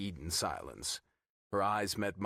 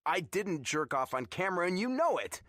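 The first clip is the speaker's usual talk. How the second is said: higher, louder defensive protest; near shout; 9 semitones higher, 3 dB louder than usual. A narrator's reading. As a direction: push back, almost shouting. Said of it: "I defended on a near shout".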